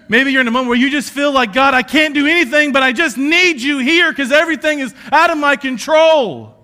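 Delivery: loud, high, fast preaching cry; preaching shout. Real, not acted. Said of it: "I yell a lot".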